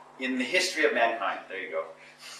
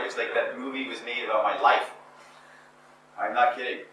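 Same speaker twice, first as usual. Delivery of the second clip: normal talking voice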